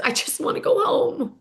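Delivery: whispered, tearful plea; weepy whisper. Retold or imitated. Tamra to a producer, whispered and weepy. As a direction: whisper it like you're about to cry. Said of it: "she gets weepy and says".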